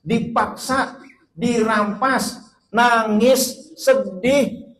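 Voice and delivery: rising dramatic list. Acted. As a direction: build up the child's grief. Dramatic child suffering. (berlebihan dramatis, suara tinggi)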